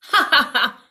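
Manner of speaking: loud taunting ha ha ha; mocking laugh. Pig taunting the wolf, loud mocking laugh. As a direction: laugh in the wolf's face.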